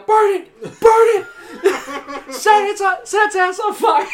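high shouted laughing yell. Retold or imitated. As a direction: yell it wildly through laughter. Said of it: "I just hear my brother yell".

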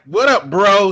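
mock awkward dude greeting. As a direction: fake street slang from an outsider. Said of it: "But it's like".

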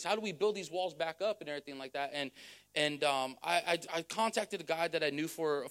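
usual speaking voice

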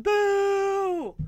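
retold group heckle; imitated crowd boo. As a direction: draw out a mock boo. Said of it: "everyone's like".

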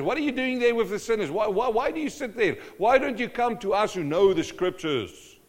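loud, fast scolding questions; indignant Pharisees. Acted. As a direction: hammer him with outraged questions. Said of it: "the Pharisees were so mad".

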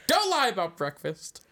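slow, loud, high scolding. Pretend. Scolding hotel person.

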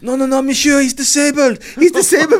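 put-on high, loud French-accented pleading; imitating a frantic French woman. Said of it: "this French woman".

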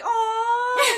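high, loud imitated squeal of adoration; gushing mock "aw"; delighted cooing. Imitates the delighted wife's reaction.

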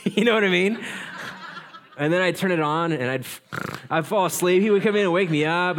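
laughing through the story, high and loud; chuckling as he confesses he dozed off. Real, not acted. Pastor laughing at himself while telling the congregation.

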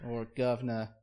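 lightly hammed-up bad Cockney accent. Co-host mocking a bad British accent, fake Cockney.

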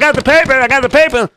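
high, loud and eager; excited shout. Acted. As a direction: blurt it out triumphantly.

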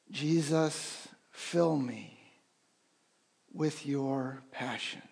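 gentle guided breath prayer; quiet breath prayer. Shown as a demonstration. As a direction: breathe the words in and out. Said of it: "If you wanna join me".

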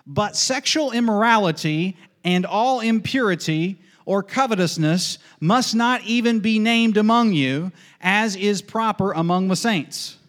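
scripture reading. Acted. Preacher reading Ephesians to the church, solemn scripture proclamation. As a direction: proclaim the verse with weight on each phrase.

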